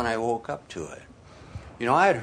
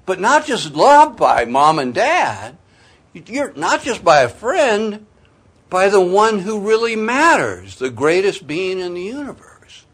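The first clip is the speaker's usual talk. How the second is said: much higher and louder, swelling with awe; impassioned preaching; 11 semitones higher, 10 dB louder than usual. Real, not acted. Preacher telling the congregation who truly loves them, impassioned and high. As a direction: lift them up with the wonder of it.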